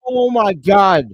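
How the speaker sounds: loud, slow disbelief; stunned. Real, not acted. Fan stunned by the play, loud and slow. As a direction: drag it out, outraged.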